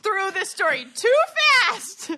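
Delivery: high, loud scared squeals. Real, not acted. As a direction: squeal in real fright. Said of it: "I'm already scared".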